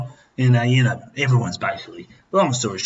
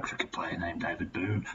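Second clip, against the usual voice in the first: name trails off into a whisper; 4 semitones lower, 12 dB quieter than usual. Real, not acted. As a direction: breathe the name out, almost no voice. Drunk, rambling about a cricketer.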